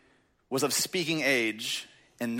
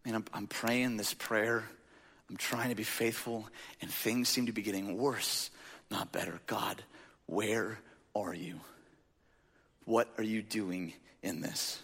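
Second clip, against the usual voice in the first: discouraged prayer; 6 semitones lower, 9 dB quieter than usual. Acted. Preacher voices a believer whose prayers go unanswered, quietly despairing. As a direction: pray it quietly, worn down, with pauses between the questions.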